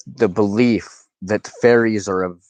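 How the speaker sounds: ordinary delivery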